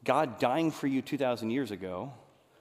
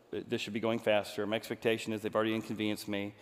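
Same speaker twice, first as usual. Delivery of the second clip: ordinary delivery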